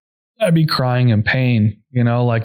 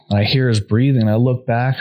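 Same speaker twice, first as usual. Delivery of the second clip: ordinary talk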